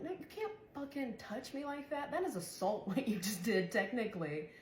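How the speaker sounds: worked-up, high-pitched objection; upset protest. Retold or imitated. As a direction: replay telling someone off, high and agitated. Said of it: "I got upset".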